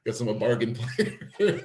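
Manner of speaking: amused, drawn-out delivery with a laugh; laughing self-mockery. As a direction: joke at your own expense, breaking into laughter. Laughing joke about himself.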